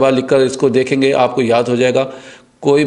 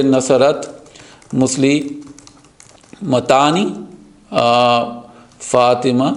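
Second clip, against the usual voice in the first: slow demo; much slower than usual. Shown as a demonstration. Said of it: "slow demo".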